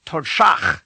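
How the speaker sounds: Todshach with curled-back d and rasped ch; Klingon insult. Shown as a demonstration. Teacher models an insult, Todshach harsh with a rasped ending. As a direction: punch the second syllable of Todshach, ending like Bach. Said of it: "one Klingon would call another".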